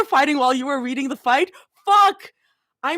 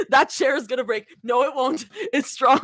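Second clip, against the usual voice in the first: normal talking voice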